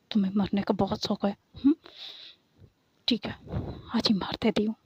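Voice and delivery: ordinary delivery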